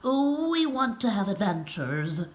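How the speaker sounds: lightly voiced eager pigeon flock; cooing pigeons. A narrator's reading. Pigeons volunteering eagerly, lightly cooing. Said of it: "they cooed".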